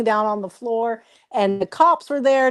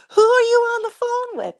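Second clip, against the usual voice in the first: very high mock-indignant demand; imitated pissed-off dad; 13 semitones higher than usual. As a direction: mimic an irritated father, pitched way up. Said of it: "And he gets all pissed".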